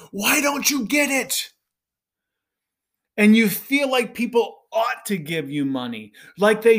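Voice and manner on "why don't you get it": higher, pleading, mock-frustrated outburst; a fed-up fundraiser throwing up his hands; exasperated plea. Fundraiser shaking a donor by the lapels, mock-exasperated. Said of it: "grab your donor by the lapels and".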